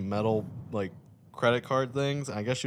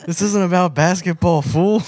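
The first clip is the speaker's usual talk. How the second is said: mock retort; 9 semitones higher, 8 dB louder than usual. Retorting to the heckler.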